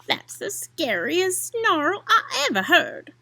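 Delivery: breathless scared gasp of a line; gasping in fright. Acted. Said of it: "Possumundus gasped".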